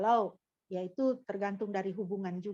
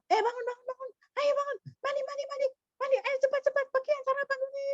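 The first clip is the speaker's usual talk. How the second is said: put-on high, rushed nagging at the kids; hurried morning mom; 14 semitones higher, faster than usual. Mother hurrying her schoolkids in the morning, high nagging. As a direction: rush the children out of bed. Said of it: "kalau dibangunkan ini kan sudah ketok".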